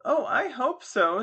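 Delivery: high, loud, drawn-out hope; emphatic young lady. Acted. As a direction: say it slowly and emphatically, pitched high. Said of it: "said Miss Charlotta Tuggs emphatically".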